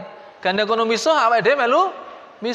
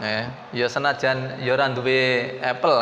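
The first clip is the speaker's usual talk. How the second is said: ordinary talk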